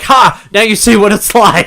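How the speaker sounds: high, loud gloating taunt; mock Loki gloat. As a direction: crow it over your brother. Loki gloating in retold scene.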